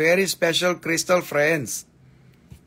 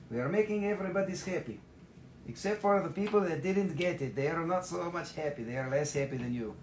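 quieter, gentle winner announcement; soft announcing; 2 semitones higher, 10 dB quieter than usual. Real, not acted. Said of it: "drops to a softer announcing voice".

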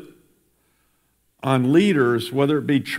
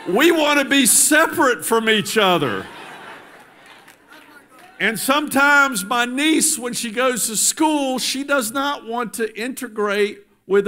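rising preaching; 7 semitones higher than usual. Acted. Governor preaching to the congregation about respecting difference, impassioned. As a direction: preach it, climbing higher each line.

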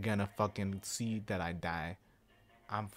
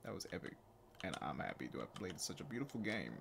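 low, soft put-on voice; 6 semitones lower, 10 dB quieter than usual. Player praising the game as it wraps up, put-on voice. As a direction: drop right down into a hushed mock-solemn voice. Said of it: "Can I stop doing that voice?".

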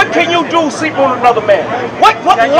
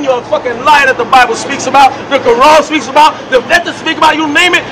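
normal talking voice

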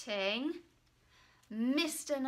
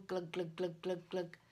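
gurgling; 4 semitones lower, 5 dB quieter than usual. Acted. Imitating tentacle going down.

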